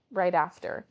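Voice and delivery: ordinary talk, nothing marked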